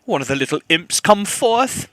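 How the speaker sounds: ordinary talk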